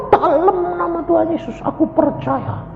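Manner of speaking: high-pitched mock crying plea; put-on wailing voice; imitated tearful prayer. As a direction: pray it like a childlike sobbing wife. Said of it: "Nangis kayak anak kecil".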